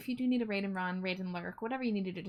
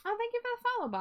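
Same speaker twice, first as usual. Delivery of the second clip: excited thanks; 3 dB louder, much faster than usual. Real, not acted. Sudden high excited thanks.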